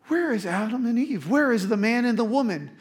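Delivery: high-pitched mock bewildered search. God supposedly searching for Adam and Eve, mock bewildered. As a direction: call around as if you have lost them. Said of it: "God did not go".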